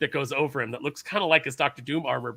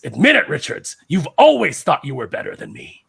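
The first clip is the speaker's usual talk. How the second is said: a louder, accusing Doom line; Doom's angry challenge; 10 dB louder than usual. Retold or imitated. Doom confronting Reed Richards over the multiverse, a loud accusation. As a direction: hurl the challenge at his rival. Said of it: "And Doom says".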